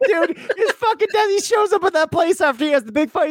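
high-pitched thrilled storytelling; excited. Real, not acted. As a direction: rush it out with fan excitement. Excited retelling.

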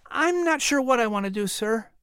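put-on a polite boy greeting the minister; young Patrick. A narrator's reading.

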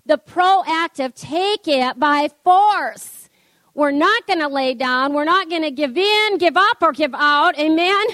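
lifted, ringing preacher's voice, building; preaching at full force. Acted. Preacher rallying the congregation to stand firm, ringing preaching. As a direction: drive it home to the congregation.

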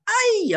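high, loud yelp of cold shock; yelled "ay". Retold or imitated. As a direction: yelp as if hitting icy water. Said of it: "וצעק". (צעקת "איי" גבוהה וחדה)

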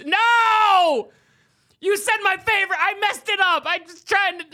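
loud, high cry of dismay; dismayed shout. Real, not acted. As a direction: yell it out, really upset. Loses the game, shouts in dismay.

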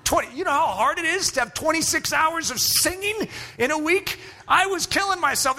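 high, pleading complaint; a touch of comic frustration; exasperated. Real, not acted. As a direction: beg for sympathy. Exasperated retelling.

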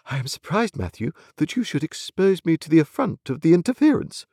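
a hint of high, haughty reproach; haughty wife. A narrator's reading. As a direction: reproach a husband with wounded dignity.

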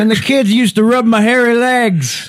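very high rambling politician impression; hammed-up, high-pitched reminiscing; Joe Biden. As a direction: chime in with a comic old-politician voice. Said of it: "Joe Biden".

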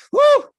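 very high, loud whoop; sudden loud celebratory woo. Acted. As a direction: let out a big cheering woo. Release after acted despair.

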